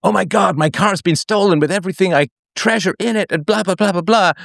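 hammed-up panicked phone call; mock panic. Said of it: "calls you in a panic".

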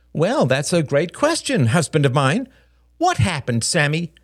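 high, pointed mock politeness; arch, high-pitched retort; sarcastic wife. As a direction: needle husband and son. Mother sarcastic to husband and son.

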